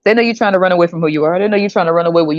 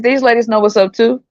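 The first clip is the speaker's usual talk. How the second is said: ordinary delivery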